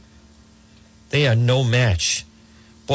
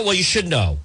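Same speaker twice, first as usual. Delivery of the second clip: usual speaking voice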